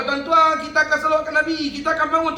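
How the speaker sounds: loud, high announcement to the crowd; rally the whole room to stand; calling out. Retold or imitated. Leader calls the congregation to stand for the salawat, loud and high.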